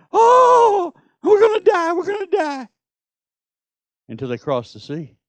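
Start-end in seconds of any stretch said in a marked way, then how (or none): 0.1-0.9 mock panic. Israelites panic as Egyptians return. 1.2-1.9 much higher, louder panicked mock cry. Israelites see the Egyptians coming, hammed-up high panic. 1.9-2.7 high shouted cry that drops away at the end; a hammed-up scream of doom that fades out; mock panic. Israelites crying out as the army returns, high mock shout fading.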